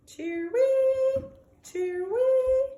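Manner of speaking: high, loud bird call; birdsong. Acted. A sad bird calling from its cage, high and loud. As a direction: whistle it like a little bird. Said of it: "I hear that bird song".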